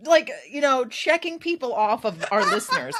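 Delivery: normal talking voice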